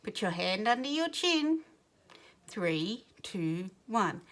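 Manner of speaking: usual speaking voice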